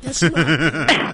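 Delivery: deep slow puppet voice. Pretend. Puppet teasing, laughing.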